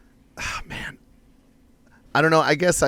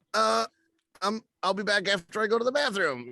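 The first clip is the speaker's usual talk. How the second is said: much higher, flustered excuse; higher, flustered excuse to the professor; flustered dodge; 10 semitones higher than usual. Retold or imitated. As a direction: squirm out of the question, high. Dodging the music professor's question.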